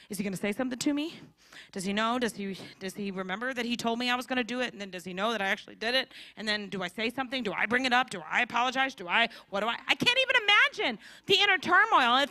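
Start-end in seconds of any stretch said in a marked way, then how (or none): 0.1-9.8 rushing, fast worried questions; anxious racing thoughts. Retold or imitated. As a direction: tumble through the questions nervously. Peter's anxious inner thoughts.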